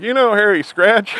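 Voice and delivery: put-on old man half-shouting to deaf neighbor; loud repeated question. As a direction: ask it up high and loud so he hears. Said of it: "he's like".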